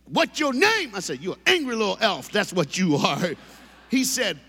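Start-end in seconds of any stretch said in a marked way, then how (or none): 0.0-0.9 put-on high, loud snapping kid voice; angry kid. Furious kid at the front desk, loud high bark. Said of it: "the angriest little brother". 1.2-3.3 teasing retort. Retold or imitated. Retorting to angry kid.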